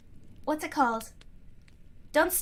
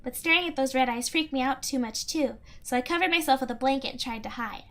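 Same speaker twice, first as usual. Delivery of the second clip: usual speaking voice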